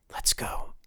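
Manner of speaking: hushed, breathy whisper to the wolf. Acted. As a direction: whisper it close and low. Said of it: "he whispered".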